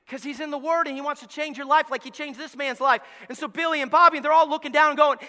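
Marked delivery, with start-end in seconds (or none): none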